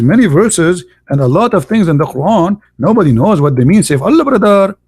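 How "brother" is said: ordinary talk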